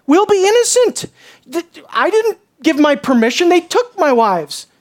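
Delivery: high-pitched feigned protest; exaggerated, indignant-sounding excuse; mock innocence. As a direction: plead innocence, hammed up.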